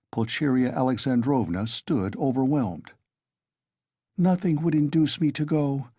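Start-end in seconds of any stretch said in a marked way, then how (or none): none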